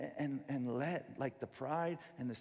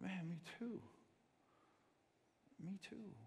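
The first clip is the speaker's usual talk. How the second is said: soft, moved voice; tender; 4 semitones higher, 10 dB quieter than usual. Retold or imitated. Father answering son softly, tender. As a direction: say it quietly, touched.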